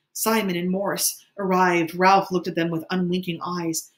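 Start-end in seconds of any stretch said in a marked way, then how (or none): none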